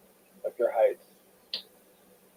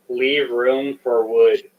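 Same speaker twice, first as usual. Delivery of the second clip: slow reading; much slower than usual. Real, not acted. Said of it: "it actually says".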